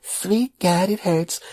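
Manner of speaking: put-on very high child's echo of Sweet God, it hurts; little kid voice. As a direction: parrot it like a small boy. Nephew repeating uncle's words.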